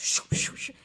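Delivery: imitating a spinning vortex; whooshing vortex sound. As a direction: push air out to mimic a swirling vortex. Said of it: "I just see this vortex".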